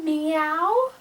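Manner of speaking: drawn-out kitty meow, rising at the end; cat meow. Shown as a demonstration. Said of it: "What does a kitty cat say?".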